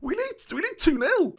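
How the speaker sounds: thrilled, rising to a squeak; excited squeal. Real, not acted. Celebrating two nil lead.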